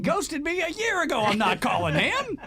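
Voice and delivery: high, huffy, slowing at the end; indignant refusal. Retold or imitated. Host voicing the guest refusing to call him, high and huffy. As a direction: dig in your heels, offended.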